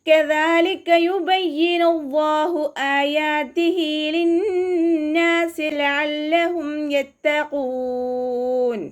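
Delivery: slow, high, chanted tajweed recitation; Quran recitation. Shown as a demonstration. Teacher opening the lesson with melodic Quran chant. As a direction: draw out each verse in melodic chant.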